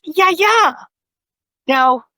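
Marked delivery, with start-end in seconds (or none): 0.0-0.8 put-on high excited toddler's exclamation, second syllable stressed; toddler's delighted cry. Toddler nephew spots grandmother behind Dad, high excited cry. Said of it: "And he goes".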